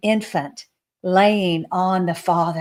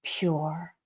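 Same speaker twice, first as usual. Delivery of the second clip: slow, soft loving praise; tender and slow; 4 dB quieter, slower than usual. Retold or imitated. Jesus gushing softly over the newborns. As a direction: savour every word lovingly.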